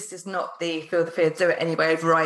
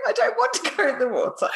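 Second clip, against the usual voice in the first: put-on higher, childish laughing plea; whiny little self; 6 semitones higher than usual. Host voicing little self at water's edge, laughing whine. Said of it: "little you going".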